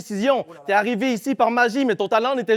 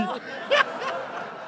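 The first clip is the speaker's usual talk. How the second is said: high breathy laugh; 8 dB quieter than usual. Real, not acted. Laughs at own mock plea.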